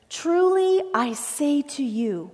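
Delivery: slow, weighty declaration; solemn and emphatic quote; emphatic, serious. Acted. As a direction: draw each word out with grave emphasis. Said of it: "That's an emphatic statement that's very serious".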